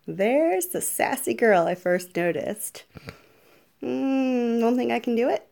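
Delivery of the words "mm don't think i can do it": ordinary talk, nothing marked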